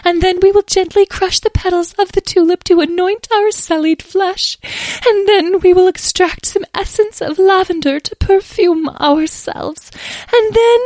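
very high-pitched, loud fairy voice; fussy fairy. A narrator's reading. Muddy fairy lists beauty remedies, fussy and high-pitched. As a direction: chatter on high as a vain little fairy.

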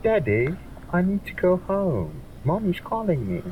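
put-on high-pitched British child voice; British little daughter. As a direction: play a small British girl calling her dad. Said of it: "sounds very British".

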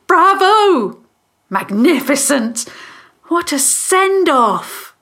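high, loud exclamations of applause; delighted cheering. A narrator's reading. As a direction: cheer them like a thrilled spectator. Onlooker cheers the victors.

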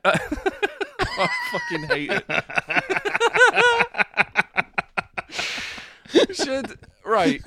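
high squealing laughter through the words; laughing. Real, not acted. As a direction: crack up at the awful pun. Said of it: "laughing at the joke".

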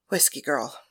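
soft, low greeting; Penthesilea greeting. Acted. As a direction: greet her quietly and warmly. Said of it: "asked Penthesilea".

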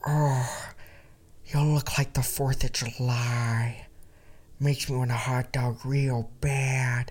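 slow soft drawl; dreamy, slowed-down celebrity voice; Jennifer Coolidge. Retold or imitated. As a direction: savour each word softly and slowly. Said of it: "She said".

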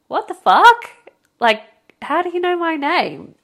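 high, loud, incredulous outburst; shocked disbelief. Retold or imitated. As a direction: blurt it out in stunned disbelief. Acting her shocked reaction.

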